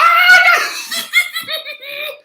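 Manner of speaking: high, loud shriek of laughter. Real, not acted. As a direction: burst into a screaming laugh. Laughing outburst.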